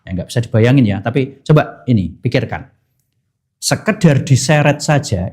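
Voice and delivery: normal talking voice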